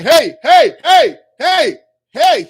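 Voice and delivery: high, loud calls of hey to people who can't hear; calling out. Retold or imitated. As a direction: shout to get their attention. Calling to unseeing people in vision.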